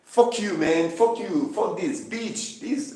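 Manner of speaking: high-pitched mock swearing outburst; imitated foul-mouthed cursing. Shown as a demonstration. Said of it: "always talking foul, using foul languages".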